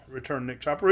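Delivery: plain reading voice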